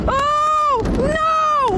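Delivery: high terrified wail; real fear, pitched very high; terrified cry. Real, not acted. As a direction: scream it as the drop hits. Screaming on the roller coaster.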